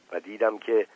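plain reading voice

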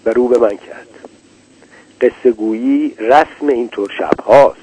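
ordinary delivery

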